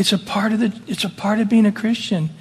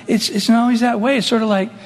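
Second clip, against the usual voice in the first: ordinary talk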